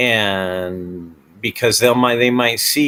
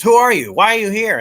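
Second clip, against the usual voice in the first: much higher, louder demanding questions; angry owner; 10 semitones higher, 4 dB louder than usual. Retold or imitated. An owner questioning the silent cameraman, demanding. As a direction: press the silent cameraman for answers.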